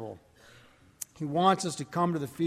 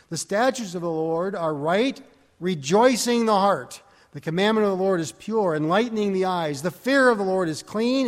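louder, measured reading of the verse; scripture reading; 3 semitones higher, 5 dB louder than usual. Acted. Preacher reading Philippians aloud to the congregation, declaiming. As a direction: proclaim the text to the congregation.

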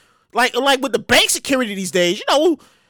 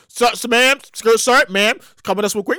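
store security; 4 semitones lower than usual. Pretend. Security stopping a shopper, lower stern voice.

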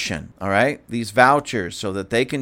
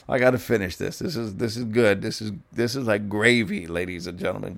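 ordinary talk, nothing marked

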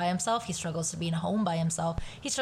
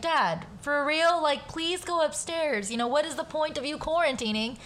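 half-joking scolding of dad; mildly snappy; 8 semitones higher than usual. Retold or imitated. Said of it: "I was a bit more snappy".

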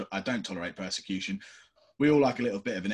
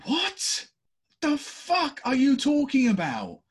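very high, loud, incredulous yelling; retold angry outburst; 6 dB louder than usual. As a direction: blast your partner for the remark. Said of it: "I'd be like".

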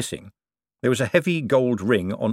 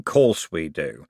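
lower, slow and savouring; slow satisfied repeat; slow echo; 4 semitones lower, much slower than usual. A narrator's reading. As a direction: roll it round the mouth. Harry King repeats.

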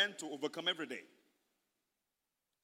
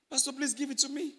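high-pitched mock begging; exaggerated, acted plea; pleading; 8 semitones higher than usual. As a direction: wheedle like a needy church member.

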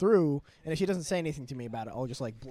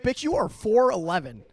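loud, high mock outrage; indignant retort; 8 semitones higher, 11 dB louder than usual. Employee firing back at short manager, indignant. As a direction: clap back at full volume, hammed-up.

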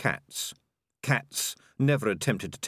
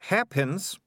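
put-on higher, bright-eyed girl voice, confident; lightly voiced eager girl; 9 semitones higher than usual. A narrator's reading. Malicia boasting to Morris, higher girl voice. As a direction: play the well-prepared young heroine, pitched up.

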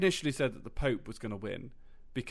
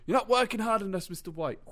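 stern instructor yell; 7 semitones higher, 5 dB louder than usual. Retold or imitated. Taekwondo instructor yelling at student.

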